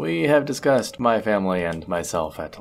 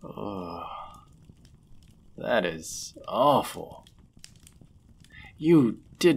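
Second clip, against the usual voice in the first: sympathetic dismay; much slower than usual. Acted. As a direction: let the sympathy slow you down. Hearing of the traveler's banishment.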